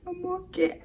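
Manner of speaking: high, soft little whimper; mock tearful. Crying victim reassuring the nurse, mock-tearful. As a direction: imitate yourself squeaking it out tearfully. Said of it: "the way I was crying".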